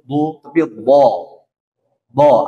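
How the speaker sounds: "bo" slow, between o and a, not "bu"; heavy bo. Shown as a demonstration. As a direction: say "bo" open, not pursed like "bu". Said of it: "jangan monyong". ("bo" antara o dan a, bukan "bu")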